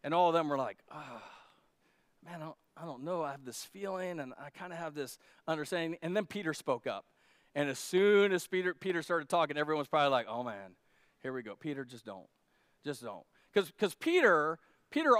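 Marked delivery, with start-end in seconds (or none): 0.9-6.0 soft, hesitant mumbling disciples; a hint of nervous disciples hedging quietly; unsure mumble. Disciples hedging to Jesus, softly unsure. 10.3-13.3 low, soft dread, begging Peter not to speak; lightly voiced disciples wincing under their breath; dreading groan. Disciples muttering at Peter, softly dreading.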